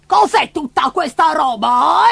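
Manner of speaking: high, drawn-out scolding; slow, high cranky squawk; shrieking grandma. Acted. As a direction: demand it as an outraged old granny. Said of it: "strillò lei".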